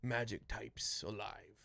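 lightly voiced gruff band leader giving orders; Voltar. Game master voices Voltar.

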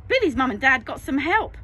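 ordinary delivery, nothing marked

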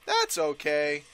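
normal talking voice

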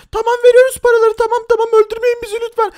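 high, loud, panicked pleading; terrified teller handing over money; begging hostage. Acted. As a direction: beg for your life in a squeaky voice.

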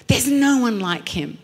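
normal talking voice

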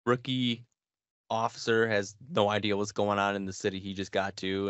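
normal talking voice

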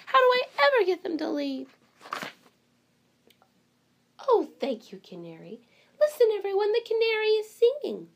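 storybook librarian welcoming animals; fussy librarian. Acted. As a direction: play the flustered, delighted librarian. Librarian voice continues.

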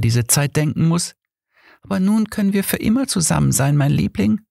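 high, longing woman's voice; ghostly lover. Acted. Dead Mary to Johnny, high and yearning. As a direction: plead sweetly as a dead lover.

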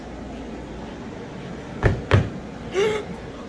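sharp frightened gasp; scared gasp. Real, not acted. Hears a weird noise.

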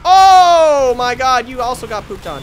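high, loud victory yell; triumphant gloating. Real, not acted. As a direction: crow wildly over each kill. Gloating after more kills.

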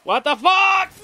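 high, loud shocked shout. Real, not acted. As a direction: yell in real shock. Attacked in game. (grito de sorpresa)

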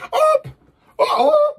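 high squealing oh oh oh; a loud, high gasp of pretend shock; mock shock.

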